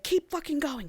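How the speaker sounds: high but soft mock yell; retold yell. Reteller voicing her order to the driver, soft mock yell. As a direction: imitate yelling without the volume. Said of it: "yells at the driver".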